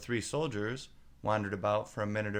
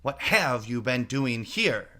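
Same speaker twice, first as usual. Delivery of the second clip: louder, slowish accusing demand; suspicious queen; 3 semitones higher, 8 dB louder, slower than usual. A narrator's reading. Queen interrogates the gardeners at the rose tree. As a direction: catch them in the act.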